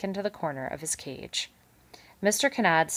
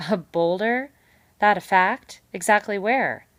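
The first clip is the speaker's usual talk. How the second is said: eager beaver; 4 semitones higher, 8 dB louder than usual. A narrator's reading. Beaver eagerly probes location.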